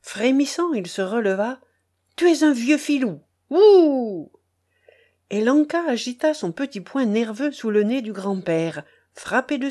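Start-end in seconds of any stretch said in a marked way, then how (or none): 2.2-4.3 higher, loud accusing yell; a boy jeering at his grandfather in anger; angry child. Acted. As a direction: hurl the insult with a jeer.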